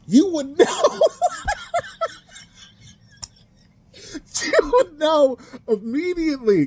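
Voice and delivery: words squeaked out through laughter; laughing high. Real, not acted. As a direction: laugh while you say it, high up. Laughing high while saying it.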